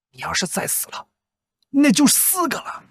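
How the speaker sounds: normal talking voice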